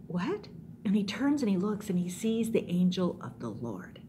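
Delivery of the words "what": quick rising question, softer; small startled query; puzzled Balaam. Acted. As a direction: ask it in sudden bewilderment. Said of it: "and Balaam goes".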